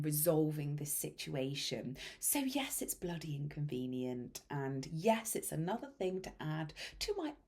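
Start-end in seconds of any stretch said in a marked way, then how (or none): 2.8-4.4 whisper. Real, not acted. Wry aside.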